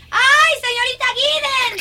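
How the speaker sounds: high-pitched excited cry; excited exclamation. Acted. Girl tells governess of a find, excited cry. Child finds another beetle.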